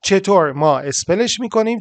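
ordinary talk

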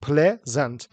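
"Pleasant" in two detached beats, ple-zant; split into syllables. Shown as a demonstration. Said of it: "دو تا".

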